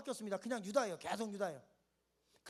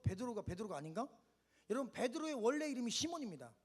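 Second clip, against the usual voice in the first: usual speaking voice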